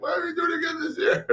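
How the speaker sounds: high-pitched weepy wail of a losing fan; mock crying. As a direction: imitate a tearful fan, voice way up high. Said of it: "be crying".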